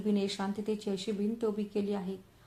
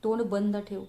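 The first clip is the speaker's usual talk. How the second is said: ordinary delivery, nothing marked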